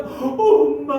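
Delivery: loud, high, drawn-out wail for mom; imitated child crying; mock tearful child. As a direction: bawl like a little daughter, hammed up. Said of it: "눈물 콧물 나며".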